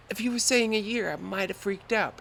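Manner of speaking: ordinary talk, nothing marked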